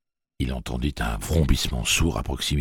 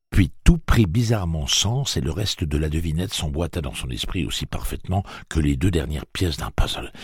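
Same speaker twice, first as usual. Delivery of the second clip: usual speaking voice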